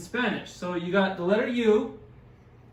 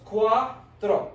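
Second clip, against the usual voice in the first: cuatro drawn out as "cua", pause, "tro"; slow syllables. Shown as a demonstration. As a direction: say cuatro slowly in two separate parts. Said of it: "Two syllables".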